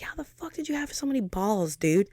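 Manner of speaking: incredulous at herself. Retold or imitated. Looking back on her past nerve, disbelieving. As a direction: ask your younger self, amazed. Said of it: "sometimes I look back and I'm like".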